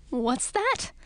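high startled squeak. Acted. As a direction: squeak it out in alarm. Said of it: "squeaked Edric".

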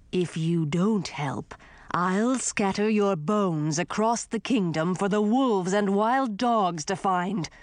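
put-on slow, looming old king threat; menacing ghost king. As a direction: threaten them slowly and coldly.

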